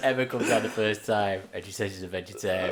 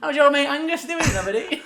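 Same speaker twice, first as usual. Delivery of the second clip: high, louder mock voice; imitating a character; 10 dB louder than usual. Friend doing a sitcom character, high and loud. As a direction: squeak out the Aberdeen line. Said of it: "the other one".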